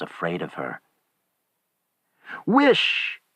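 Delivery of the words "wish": high, forceful one-word command; strong voice. A narrator's reading. Wife orders husband to make the wish, strong voice. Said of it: "she said in a strong voice".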